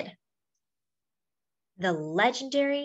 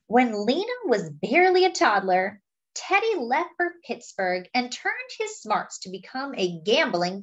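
put-on lively picture-book read to children; story narration; 4 semitones higher than usual. A narrator's reading. Reading a Lena Horne picture book to friends, lively storytelling. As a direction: tell it like a storyteller to a class.

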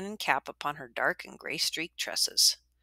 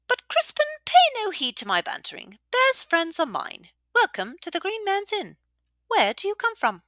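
high, hearty innkeeper voice; welcoming old widow; 5 dB louder than usual. A narrator's reading. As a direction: make the shy boy feel at home.

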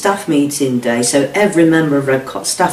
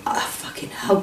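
whispered curse; 4 semitones higher, 10 dB quieter than usual. Retold or imitated. Recalling rescue moment.

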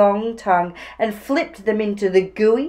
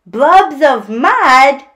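amused Dingo; 5 semitones higher, 10 dB louder, much slower than usual. A narrator's reading. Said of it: "Dingo laughed".

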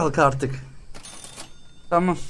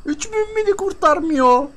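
mock protest; 10 semitones higher than usual. Haggling, voicing exaggerated protest.

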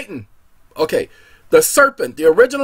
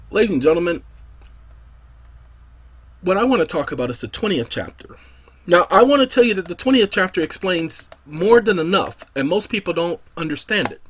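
normal talking voice

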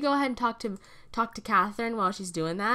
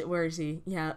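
ordinary delivery

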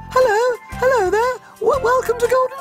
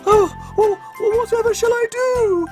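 high, worried goose voice; the goose in a panic; distressed goose; 10 semitones higher than usual. A narrator's reading. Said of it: "she cried".

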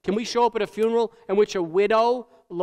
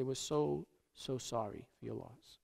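much softer, lower near-whispered sympathy, stretched so; barely audible condolence; 6 semitones lower, 15 dB quieter than usual. Retold or imitated. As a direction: awkwardly hush it to the widow. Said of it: "we walk up to the person and we say".

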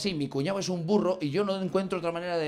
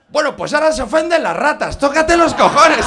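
outraged shout; 7 semitones higher, 11 dB louder than usual. Real, not acted. Outraged punchline at rats. (grito indignado)

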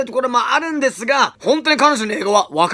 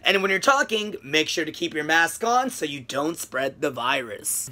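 low, slow and flowing, fading softer; smooth natural English; 6 semitones lower, 5 dB quieter, slower than usual. Shown as a demonstration. Teacher showing his own smooth version. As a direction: let the words run together smoothly. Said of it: "僕でしたら".

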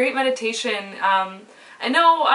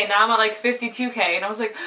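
normal talking voice

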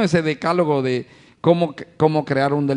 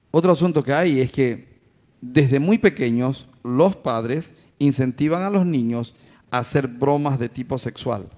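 normal talking voice

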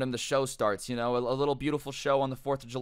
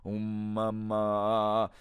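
slow, drawn-out low hum; long monotone mumbo hum; imitated chanting hum; 4 semitones lower, much slower than usual. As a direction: drone it out as a mock trance chant.

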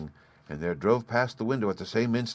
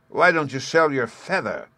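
high, loud sneer; mock him with a sneer; 6 semitones higher, 4 dB louder than usual. A narrator's reading. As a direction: brother sneering at the old man. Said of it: "said Hans sneeringly".

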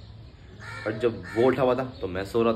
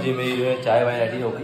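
usual speaking voice

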